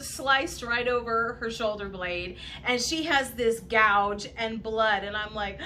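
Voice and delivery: normal talking voice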